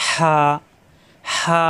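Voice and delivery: হা with an airy throat h and long আ; drawn-out vowel. Shown as a demonstration. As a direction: stretch the vowel after the breathy h in হা. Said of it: "এখানে কিন্তু একটু টানা যায়". (টেনে হা)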